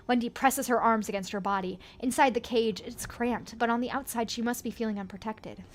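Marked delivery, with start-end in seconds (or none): none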